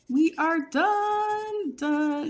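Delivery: high, slow, happy announcement; excited. Real, not acted. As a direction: draw it out with delight. Finishing the savings chart.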